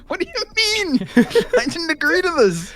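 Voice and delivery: very high, drawn-out squeal; laughing protest. Real, not acted. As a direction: squeal your objection, half laughing. Protests demand for pirate accent.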